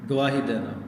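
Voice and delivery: ordinary talk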